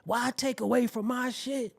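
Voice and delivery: ordinary delivery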